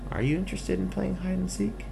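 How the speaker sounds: lightly voiced chirpy skunk; cheerful skunk. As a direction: chat hopefully as a little skunk seeking a playmate. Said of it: "the skunk said".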